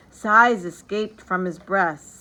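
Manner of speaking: usual speaking voice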